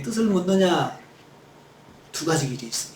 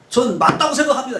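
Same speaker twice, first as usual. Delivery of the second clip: higher-pitched acted back talk; 6 semitones higher, 4 dB louder than usual. Jonah talking back to God, insisting he is right, stubborn retort. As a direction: play a stubborn retort to authority. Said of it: "자신이 합당하다고 말대답한 거예요".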